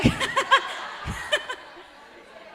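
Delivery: short breathy laugh, high; a real burst of laughter at her own joke. Real, not acted. Preacher laughs at her joke to the congregation, amused.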